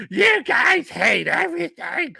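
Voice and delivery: high, drawn-out whine imitating complainers; mock whining viewers. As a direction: mimic whiny commenters, stretched and high. Mimics complaining viewers, laughing.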